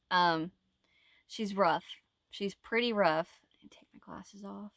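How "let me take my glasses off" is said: a soft breathy aside under the breath; whisper. Real, not acted. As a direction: whisper it to yourself as you take off your glasses. Aside while removing glasses.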